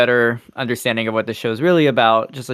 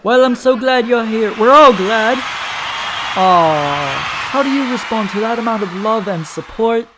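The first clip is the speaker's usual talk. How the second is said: talk show host; 9 semitones higher, slower than usual. Pretend. Host continues interview.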